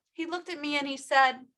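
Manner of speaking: ordinary talk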